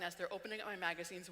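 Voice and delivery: usual speaking voice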